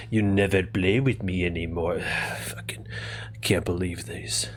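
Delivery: Strahd in a lightly put-on French accent, sulking; sulky vampire lord faking French; mock French accent. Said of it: "He mimics a French accent".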